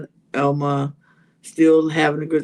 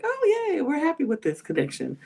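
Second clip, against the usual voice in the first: mock cheer; 8 semitones higher, 5 dB quieter than usual. Mocking everybody's fake enthusiasm.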